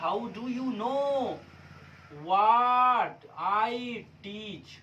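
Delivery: each word stretched far out; very slow dictation. Shown as a demonstration. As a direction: dictate slowly so students can write. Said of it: "हो गया". (बहुत धीरे-धीरे लिखवाना)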